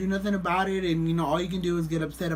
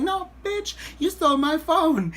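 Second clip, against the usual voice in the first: loud, high-pitched mock outrage; retold angry accusation; 11 semitones higher, 4 dB louder than usual. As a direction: accuse him, voice shooting up. Acted accusation of the stranger.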